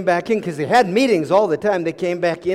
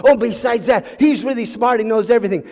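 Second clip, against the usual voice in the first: raised, louder gushing; eager boasting; 4 semitones higher, 6 dB louder than usual. Acted. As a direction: pile on the praise excitedly. Advisor piles on praise eagerly.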